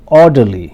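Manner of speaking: "orderly" with OR punched loud, the rest falling away; first-syllable stress. Shown as a demonstration. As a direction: drill "orderly", hitting the first syllable hard. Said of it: "put stress on the first syllable". ("orderly" के पहले syllable पर ज़ोर)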